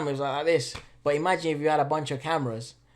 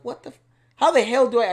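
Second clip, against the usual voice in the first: desperate; 8 semitones higher, 8 dB louder than usual. Retold or imitated. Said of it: "I remember I was like".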